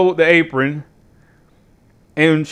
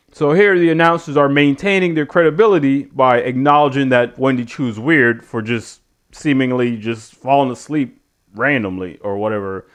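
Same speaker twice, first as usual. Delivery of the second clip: ordinary talk, nothing marked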